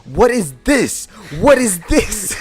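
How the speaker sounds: high, loud outraged yelling; outraged teacher. Retold or imitated. Teacher outraged at the second picture, high loud yelling. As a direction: shriek it twice, losing it. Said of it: "He's just like".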